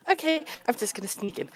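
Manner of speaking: put-on light, quick, hushed girlish voice; girly. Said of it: "she's very girly".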